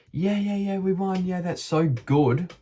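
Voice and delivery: high, excited celebrating crowd; imitating everyone cheering a win; mock cheering. Everyone after the race, cheering high and excited. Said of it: "Everyone was".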